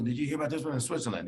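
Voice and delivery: a quick, slightly put-on voice of a patient rattling off internet finds; eager patient. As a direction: breezily imitate a patient who has read too much online. Patient quoting internet cancer cases.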